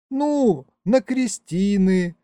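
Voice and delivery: put-on high, slow young woman's voice; girl patiently explaining. A narrator's reading. Young mother explains to the puzzled narrator, high and slow. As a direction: spell it out, drawn out and high. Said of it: "доходчиво пояснила девушка".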